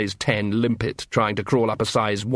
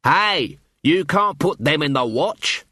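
higher, mildly raised protest; mild dwarf shout; 4 semitones higher, slower than usual. A narrator's reading. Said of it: "shouted a dwarf from the crowd".

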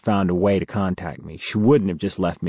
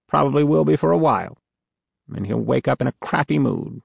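a slight edge, higher and quick; edgy; 6 semitones higher than usual. A narrator's reading. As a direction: say it with a bit of bite. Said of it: "with a bit of an edge to her voice".

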